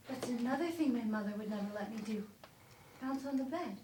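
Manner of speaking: normal talking voice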